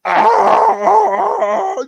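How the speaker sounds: high, loud dying wail; mock death cry. Palpatine crying out as he disintegrates. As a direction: fake a villain's death scream.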